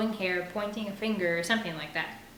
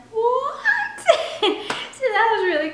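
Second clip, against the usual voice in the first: bursts into a high laugh; 10 dB louder than usual. Real, not acted. Reader laughing at a listener's text.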